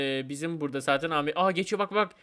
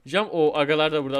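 ordinary delivery

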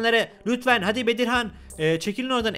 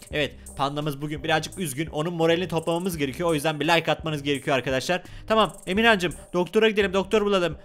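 ordinary delivery, nothing marked

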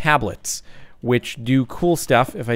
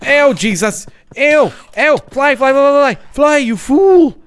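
high loud yelling, drawn out; frantic shout; 12 semitones higher, 9 dB louder than usual. Real, not acted. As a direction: bellow it as you flee. Fleeing mobs, Gandalf quote.

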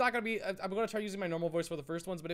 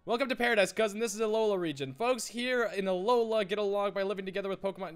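lightly voiced, louder game character; hearty welcoming cousin; 6 semitones higher, 5 dB louder than usual. Cousin welcomes player to Alola, lightly acted hearty welcome. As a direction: play the host welcoming a newcomer.

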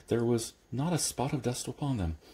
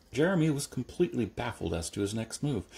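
usual speaking voice

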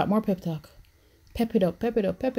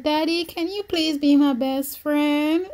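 put-on higher, slow little-girl plea to a father; pleading daughter; 7 semitones higher, slower than usual. Daughter asking daddy to be best friend, pleading. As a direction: draw out the plea sweetly, pitched up. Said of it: "'cause she's like".

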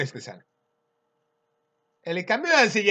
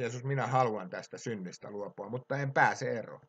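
softly voiced, lower plea to Jesus; acted humble confession, hushed a little; humble prayer; 4 semitones lower, 7 dB quieter than usual. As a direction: drop the voice as if praying humbly. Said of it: "kun me rukoilemme Jeesusta nöyränä".

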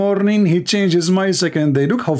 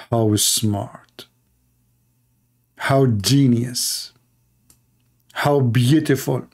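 sarcastic praise; 5 semitones lower, much slower than usual. Acted.

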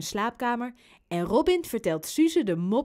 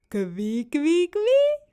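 playful tickle squeal; 6 semitones higher, 5 dB louder, much slower than usual. Acted. Robin's fingers head for Suze's belly, rising playful squeal. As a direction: start at talking pitch, leap up high and loud.

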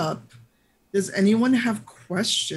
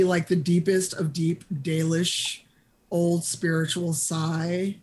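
usual speaking voice

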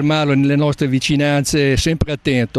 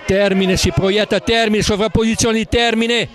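quick live football commentary; live play-by-play; 5 semitones higher than usual. Acted. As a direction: call the action as it happens.

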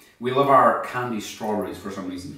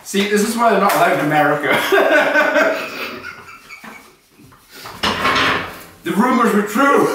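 laughing; 11 semitones higher, 6 dB louder than usual. Real, not acted. Laughing at Kinder egg.

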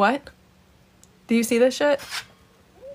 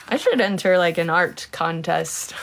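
ordinary talk

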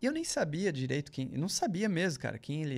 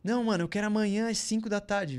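higher and louder, impatient insistence; mock impatient boss; demanding boss; 6 semitones higher, 5 dB louder than usual. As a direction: demand it now, no excuses. Demanding boss voiced in story.

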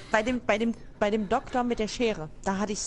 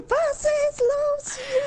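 mock panic; 4 dB louder, much slower than usual. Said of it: "ich drücke auch ... Pause so".

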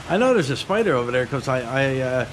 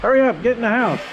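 shouting; 6 semitones higher than usual. Real, not acted. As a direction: call her inside over the rain. Calling her in from rain.

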